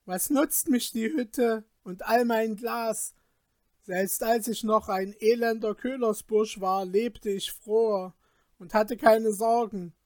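loud, high, bitter complaint; upset complaint. Acted. Peter rails at the Glasmännlein over his ruin, loud and bitter. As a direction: heap the blame on him loudly.

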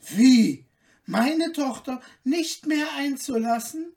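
loud, high indignant shout; the queen bursts out in anger at the insult; outraged cry. Acted. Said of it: "rief die Königin".

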